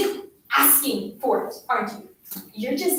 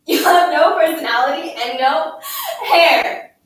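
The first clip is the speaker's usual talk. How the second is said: loud, forceful berating; angry tirade; 8 dB louder than usual. A narrator's reading. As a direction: hammer the silent child with insults and questions. Bully's scolding tirade continues.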